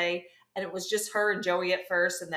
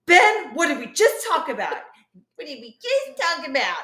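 scolding; 7 semitones higher, 8 dB louder than usual. Retold or imitated. Ross scolding Ben in retold scene.

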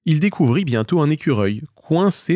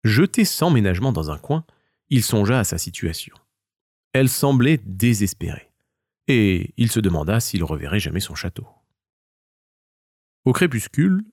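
plain reading voice

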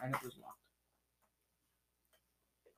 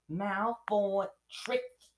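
high, drawn-out character voice; Hermione's voice; 6 semitones higher, 4 dB louder, much slower than usual. Acted. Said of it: "said Hermione to Harry".

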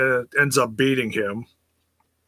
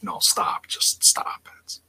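hushed pleading whisper; whispered stop; 6 dB quieter than usual. Retold or imitated. Husband begging wife to stop, whispered. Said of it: "And I'm like".

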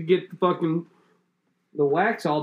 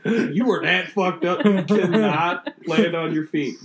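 mock scolding cat; 2 semitones higher, 4 dB louder, slower than usual. Talking to the fallen cat.

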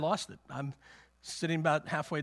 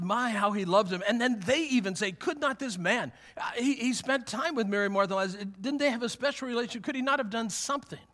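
raised, higher crowd voice; voiced crowd; 7 semitones higher, 5 dB louder than usual. Crowd at the tomb questioning Jesus, raised voice. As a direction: let the crowd's question ring out.

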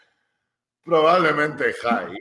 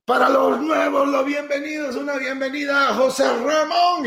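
shouted announcement, much higher pitched; mock preacher shouting; 12 semitones higher than usual. Preacher shouting a welcome to the newcomers. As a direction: hype up the newcomers like an emcee. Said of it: "empiezan a gritar".

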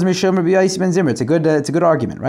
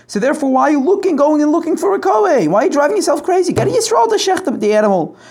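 exasperated urging; 9 semitones higher than usual. Acted. Teacher acting Shmuel urging Eli.

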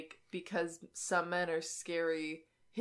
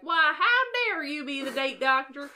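higher, louder put-on Southern accent; 6 semitones higher, 6 dB louder than usual. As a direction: play the outraged Southern friend in Southern accent. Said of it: "I hadn't gotten to do that accent until now".

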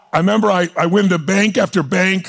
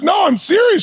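urgent insistence; 12 semitones higher than usual. Retold or imitated. Young man pleading with the banker, pitch jumping high. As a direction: insist hard that he means it.

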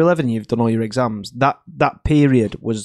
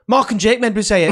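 loud excuse; 9 semitones higher, 6 dB louder than usual. Retold or imitated. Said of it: "he went".

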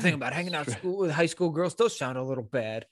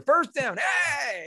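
mock stadium cheer; 9 semitones higher, much slower than usual. Imitating the stadium loudspeaker.

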